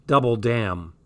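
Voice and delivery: usual speaking voice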